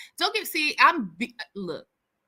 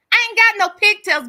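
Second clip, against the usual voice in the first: mock retort; 9 semitones higher, 9 dB louder than usual. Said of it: "Tell it she doesn't have".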